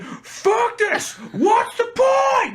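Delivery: high loud exasperated yell, half laughing; mock furious shout at the broken shelf; retold angry outburst. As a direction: relive the fit of rage with a laugh. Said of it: "I said".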